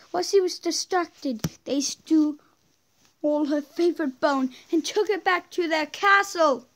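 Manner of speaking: once-upon-a-time reading voice; storybook narration. Acted. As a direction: lightly read fairy-tale narration. Reads game story aloud.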